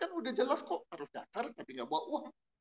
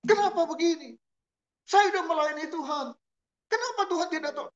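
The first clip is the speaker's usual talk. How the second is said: a mock whiny complaint to God, much higher and louder; complaining prayer; 10 semitones higher, 10 dB louder than usual. Preacher mimics a believer grumbling at God, loud and high. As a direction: play the grumbling believer, whining loudly upward. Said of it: "Yang ada adalah".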